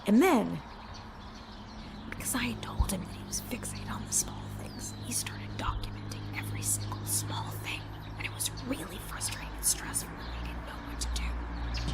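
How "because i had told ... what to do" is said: hushed whisper so the neighbor won't hear; whispering on the phone. Real, not acted. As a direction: whisper it, barely voiced. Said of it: "so I have to whisper".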